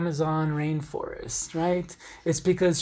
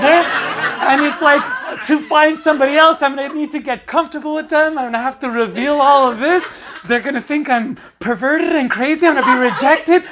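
much higher, louder, fast and laughing; anxious panic, laughing; 9 semitones higher, 8 dB louder, faster than usual. Real, not acted. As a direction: spiral into panic. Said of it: "anxious spiral about kinks".

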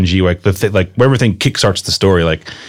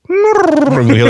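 high-pitched, badly faked horse whinny; high mock whinny running into speech; horse impression; slower than usual. Shown as a demonstration. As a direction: do a very bad horse neigh. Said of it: "that was my horse impression".

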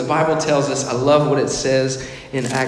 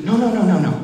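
emphatic refusal; 6 semitones higher, 4 dB louder, faster than usual. Acted. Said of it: "rejecting the law".

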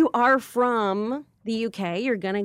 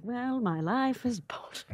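whispered, slow and deflated; defeated whisper; 4 semitones higher, 7 dB quieter than usual. Retold or imitated. Comedian alone after the audience leaves, defeated whisper. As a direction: whisper it to yourself, crushed.

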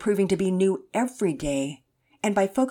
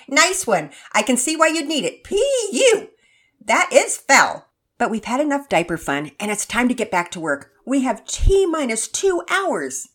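higher, louder laughing mother; a mom chuckling then taking charge; amused mom voice; 6 semitones higher, 8 dB louder than usual. Acted. Narrator voices Mama Peters laughing.

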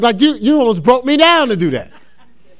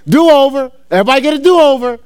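excited proclamation; 5 semitones higher, 5 dB louder than usual. Acted. Preaching hype.

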